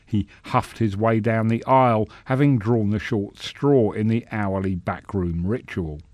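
ordinary delivery

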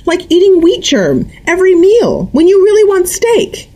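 high, loud, rising harangue; agitated mother. A narrator's reading. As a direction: lay out her theory, getting shriller. Mother builds her argument.

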